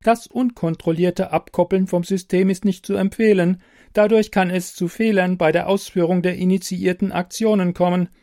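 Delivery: a helpful machine, evenly spoken; computer voice. Acted. Computer voice in story.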